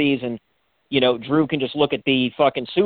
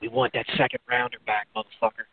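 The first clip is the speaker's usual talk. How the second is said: softer, low taunting aside into his ear; leaned-in mock threat; 5 semitones lower, 7 dB quieter than usual. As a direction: lean in and growl a petty jab, quietly. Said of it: "maybe he leans in and goes".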